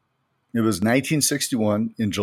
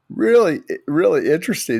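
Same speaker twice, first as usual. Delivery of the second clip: high, bright burst of enthusiasm; really keen about it; excited; 6 semitones higher, 3 dB louder than usual. Real, not acted. Excited reaction to translation.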